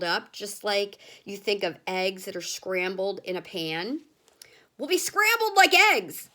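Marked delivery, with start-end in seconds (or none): 4.8-6.3 much higher, loud with stress on like; frightened grandma. A narrator's reading. Grandma fearing they'll hit the factory, high squeal. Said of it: "said Grandma Georgina".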